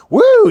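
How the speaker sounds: loud high whoop; a car-dealer cheer. Real, not acted. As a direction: let out a big woo. Whoop of excitement.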